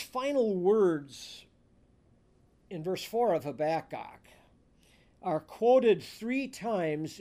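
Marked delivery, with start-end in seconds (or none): none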